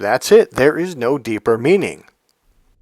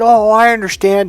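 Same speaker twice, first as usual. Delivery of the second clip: high, loud mock protest; imitating an overeager client, pitched up; insistent client; 9 semitones higher, 6 dB louder than usual. Client claiming he understands, insistent.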